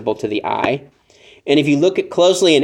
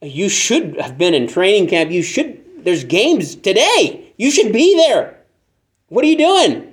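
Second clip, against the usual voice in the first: incredulous building; 5 semitones higher than usual. Retold or imitated. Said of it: "And you're like".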